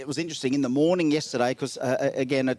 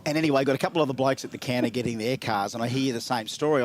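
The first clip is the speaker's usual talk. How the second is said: ordinary talk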